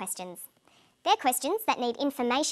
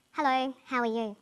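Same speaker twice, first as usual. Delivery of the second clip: sad, subdued greeting on a level pitch. Shown as a demonstration. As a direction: say hello as if feeling down. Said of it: "or sound sad".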